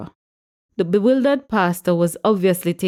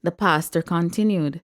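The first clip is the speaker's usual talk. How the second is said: ordinary delivery, nothing marked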